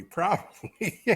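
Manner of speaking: laughing it out. Real, not acted. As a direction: chuckle through the word. Laughing at vodka joke.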